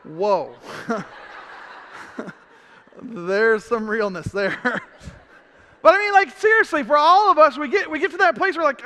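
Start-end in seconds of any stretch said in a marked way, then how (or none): none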